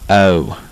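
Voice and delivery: "O" with an A blended onto its front; Southern twang O. Shown as a demonstration. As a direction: slide from A into "O" in one syllable. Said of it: "sort of just blends in together".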